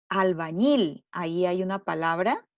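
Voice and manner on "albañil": "albañil" with ÑIL louder and raised in pitch; stress on final syllable. Shown as a demonstration. Teacher showing pupils the tonic syllable, "albañil" stressed on ÑIL. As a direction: punch the last syllable of "albañil". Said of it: "La sílaba ñil".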